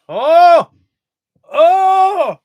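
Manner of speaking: sudden loud high yelp of surprise; startled cry. Real, not acted. Startled by the dog mid-stream, a loud yelp. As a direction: jump and cry out, caught off guard.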